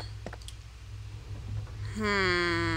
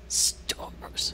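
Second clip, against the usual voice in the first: low whispered growl; whispered monster voice; 5 dB quieter than usual. Retold or imitated. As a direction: hiss it like the monster. Whispered Nemesis imitation.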